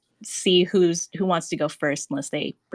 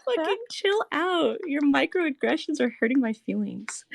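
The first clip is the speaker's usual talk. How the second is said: mock offended chatter; 7 semitones higher than usual. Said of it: "They're like".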